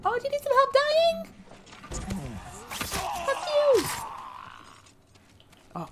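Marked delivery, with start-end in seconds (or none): 0.1-3.8 much higher mock voice of her friend. Retelling a partner mission, high mocking imitation of her friend. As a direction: squeak it out like the annoying teammate. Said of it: "she just kept getting".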